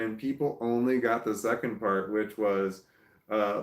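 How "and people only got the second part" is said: ordinary talk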